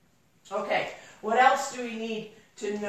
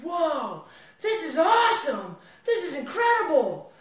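higher, shouted-out amazement; acted joyride exclamations; exhilarated; 5 semitones higher than usual. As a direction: relive the thrill, crying out in delight. Retold thrill of the drive.

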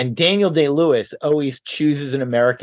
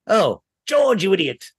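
put-on higher, old film star exasperation; Ronald Coleman voice; 6 semitones higher than usual. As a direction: voice actor doing Ronald Coleman. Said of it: "saw the name George a lot and went".

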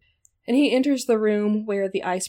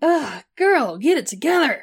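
high, loud sassy scold; 7 semitones higher, 4 dB louder than usual. Retold or imitated. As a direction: scold her like a sassy friend.

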